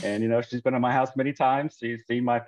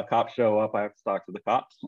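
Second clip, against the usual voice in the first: ordinary talk, nothing marked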